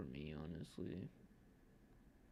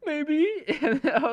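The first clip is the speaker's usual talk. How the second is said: laughing; 9 dB louder, slower than usual. Retold or imitated. Sheepish reply while laughing.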